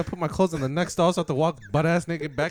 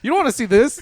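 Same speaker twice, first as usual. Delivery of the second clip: exaggerated awkward; 8 semitones higher, 8 dB louder than usual. Mock awkward stall moment.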